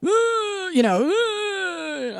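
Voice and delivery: high strained grunts; sputtering grunt. Retold or imitated. Dad sputtering at the drunk, high strained grunts. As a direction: sputter like an outraged old man.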